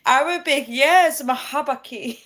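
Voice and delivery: high, giggly answer through laughter; laughing. Real, not acted. Streamer answering a viewer's comment, laughing. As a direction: laugh as you say it.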